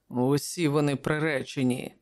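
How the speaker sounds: plain reading voice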